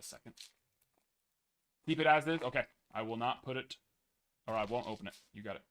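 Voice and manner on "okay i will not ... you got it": normal talking voice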